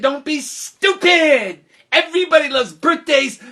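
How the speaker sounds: put-on high, loud childish scoffing; scornful retort. Friends dismissing a classmate's birthday warning, scornful retort. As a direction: brush the worry off loudly, stretching the key word.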